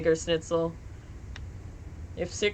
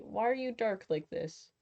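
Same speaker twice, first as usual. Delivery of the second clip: ordinary talk, nothing marked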